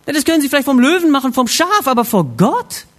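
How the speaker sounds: loud, high, rapid disbelief slowing at the end; incredulous. Real, not acted. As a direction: build to an astonished question. Empört sich über das Versteck. (laut und ungläubig)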